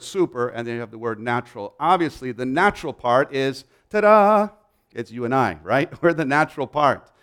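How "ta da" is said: high, loud, drawn-out ta-da; sing-song showy flourish; playful fanfare. Pretend. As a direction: announce it like a mock grand reveal. Playful reveal of the natural part.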